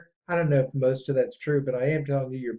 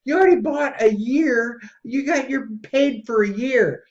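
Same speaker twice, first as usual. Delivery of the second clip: exasperated shout; 8 semitones higher than usual. Retold or imitated. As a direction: shout it like you can't believe he paid twice. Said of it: "I go".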